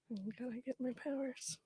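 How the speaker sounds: whispered aside. Real, not acted. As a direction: whisper it under your breath. Player aside.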